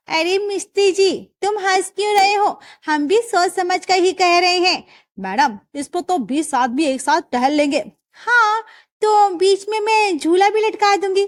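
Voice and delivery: high, spirited daughter-in-law answering back; young daughter-in-law, indignant. Acted. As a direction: put the laughing mason in his place. Daughter-in-law scolds the mason.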